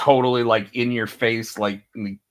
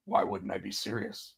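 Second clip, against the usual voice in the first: low, very quiet straight-faced retort; understated, dead-serious mock reply; deadpan; 4 semitones lower, 13 dB quieter than usual. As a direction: imitate a deadpan comeback, soft and dropped in pitch. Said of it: "it's such a deadpan response".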